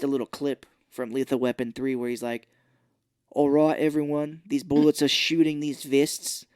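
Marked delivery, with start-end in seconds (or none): 3.3-6.5 put-on Australian accent slipping through; Mel Gibson. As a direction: imitate a leaky Australian accent. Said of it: "his Australian accent, ... was coming through".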